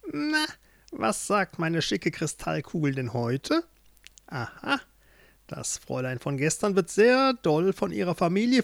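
gloating witch. Acted. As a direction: play a witch mocking her captive. (hämische, hohe Hexenstimme)

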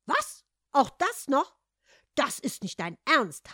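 loud disbelief, starting on a high yelp; appalled. A narrator's reading. Mother turns to her son over the boots, appalled. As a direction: protest in shocked disbelief.

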